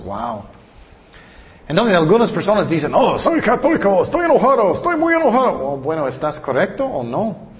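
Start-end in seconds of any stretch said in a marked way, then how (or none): 2.9-5.6 high-pitched, worked-up boasting; hammed-up excitement, pitch rising with each claim; mock excited believer. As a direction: imitate an overexcited churchgoer, voice climbing higher. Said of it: "estoy muy emocionado".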